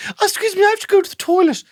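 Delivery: high, prim, posh excuse; posh little voice. Retold or imitated. Friend sneaking out of class in a prissy posh voice. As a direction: put on a dainty posh voice to excuse yourself. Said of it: "that weird little posh voice that you do".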